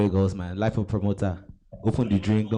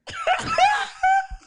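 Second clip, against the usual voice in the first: shrieking laugh. Real, not acted. Laughs at his own joke.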